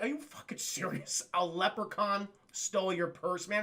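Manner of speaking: understated, sceptical echo; mock incredulous cop. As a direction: doubt the report, keeping it down. Retold cop doubting the woman.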